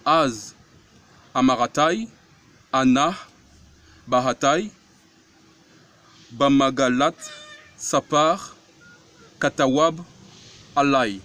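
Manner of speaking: loud, slow syllable-by-syllable Hebrew reading, each word rising; reading Hebrew aloud. Shown as a demonstration. Preacher sounding out the Hebrew psalm for the congregation, loud and deliberate. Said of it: "On reprend".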